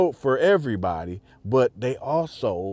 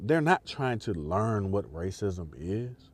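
ordinary delivery

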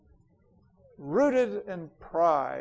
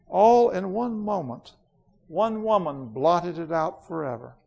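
proud queen Jadis; 2 semitones higher than usual. Acted. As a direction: speak like a queen who ended a world. Reads Jadis's lines.

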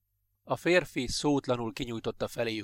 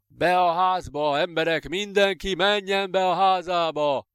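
loud, slow, higher bellowed order; policeman's yell; 6 semitones higher, 5 dB louder than usual. Acted. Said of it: "ordította el magát a rendőr". (a rendőr lassan, hangosan, magasabban ordít az emberekre)

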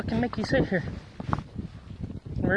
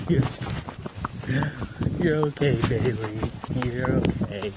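soothing reassurance; 8 semitones lower than usual. Real, not acted. Comforting the dog Bailey.

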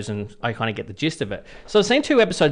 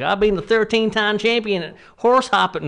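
put-on southern accent; 7 semitones higher than usual. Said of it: "that was my southern accent".

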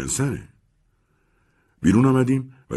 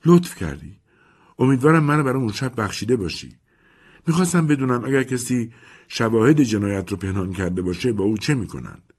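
anxious Mimi; 6 semitones higher than usual. Acted. Mimi asks Nick anxiously.

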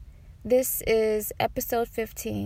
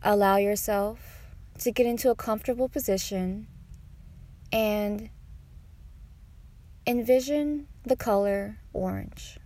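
guided meditation; 4 dB quieter than usual. Acted. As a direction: guide them unhurriedly through each breath.

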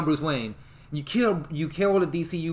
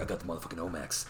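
low, quiet, quick hero voice; Batman; 10 semitones lower, 12 dB quieter, faster than usual. Retold or imitated. Keaton's Batman after saving everyone, low and quick. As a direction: toss it off deep and hushed.

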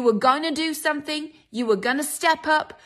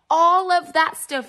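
louder, slower and higher, pressing each word; emphatic; 5 semitones higher, 5 dB louder than usual. Real, not acted. Preacher to congregation, emphatic slow push. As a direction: drive it home to the congregation.

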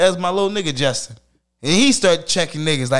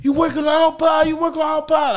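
excited shout; 10 semitones higher than usual. Retold or imitated. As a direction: call out eagerly like kids banging on a door. Kids banging on pod door.

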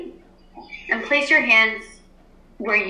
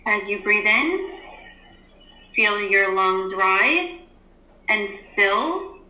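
guided meditation. Acted. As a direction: ease the class into stillness. Teacher leads class meditation.

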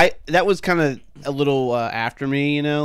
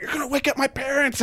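urgent scolding; 13 semitones higher than usual. Retold or imitated. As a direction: frantically act out telling a friend off. Retold scolding of noisy friend.

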